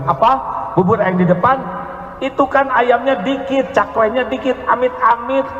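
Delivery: high, scornful whine; picky complainer. Retold or imitated. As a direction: turn your nose up at the offer. Picky person rejecting bubur ayam.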